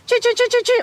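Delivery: high, sharp chit calls; alarm chirps. Shown as a demonstration. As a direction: bird alarm imitation, high. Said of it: "birds that you're hearing are going".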